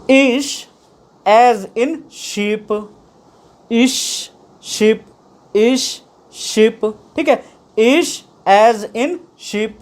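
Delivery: "ish" and "sheep" repeated slowly; slow pronunciation drill. Shown as a demonstration. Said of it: "इश की sound आ रही है इसमें".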